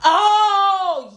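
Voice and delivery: high, loud drawn-out "oh"; sudden realization. Real, not acted. Friend realizing she misunderstood who was meant, loud surprised realization. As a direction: exclaim it as the mix-up clears.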